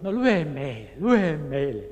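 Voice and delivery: loud eager chant, "lue" stressed; shouting inmates. Retold or imitated. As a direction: call out for him to read. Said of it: "niin he huusivat".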